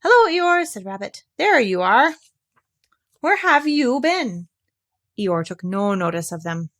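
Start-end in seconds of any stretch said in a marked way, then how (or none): 0.0-0.6 high, loud bright greeting; Rabbit voice. A narrator's reading. 1.4-4.5 high, brisk questioning; Rabbit voice. A narrator's reading.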